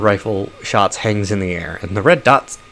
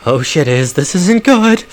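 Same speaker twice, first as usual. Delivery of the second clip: rising to a high, loud panicked cry; a frightened girl losing her nerve; afraid; 7 semitones higher, 5 dB louder, slower than usual. Acted. Orc girl to the dwarf under fire, panicked. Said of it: "her eyes wild with fear".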